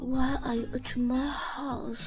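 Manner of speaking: put-on slow, soft drunken drawl; drunken slur. Drunk woman asks why he is at her house, slurred. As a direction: drag the words out softly, tipsy.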